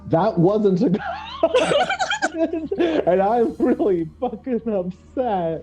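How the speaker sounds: high, drawn-out wail breaking into laughter; hammed-up dismay from a duped creature, laughing through it; slow, high mock whine with giggles; upset creature. Acting out the creature realizing its mistake.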